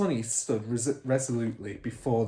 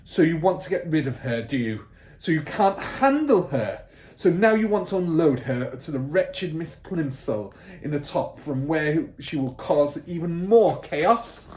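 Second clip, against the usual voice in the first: loud, higher taunting bully; sneering accusation; 6 semitones higher, 7 dB louder than usual. Acted. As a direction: taunt her. Trunchbull voiced.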